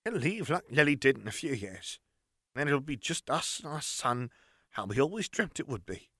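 a weary mother hoping for quiet years; sighing aunt. A narrator's reading. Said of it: "Aunt Petunia sighed".

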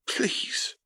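unvoiced, breathy plea; a whispered, aching please. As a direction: Katie whispering her plea. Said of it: "Katie whispered".

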